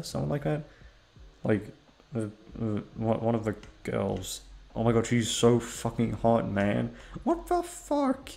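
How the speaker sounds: ordinary talk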